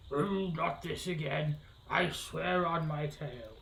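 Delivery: slow, drawn-out grumbling creature voice; put-on character voice. Witch annoyed at a visitor, slow grumbling character voice. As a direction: grumble it out, stretching the words. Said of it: "a southern accent for every character".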